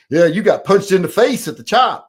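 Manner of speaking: loud, high mock jeer; mimicked taunt. Mimicking his accuser's taunt.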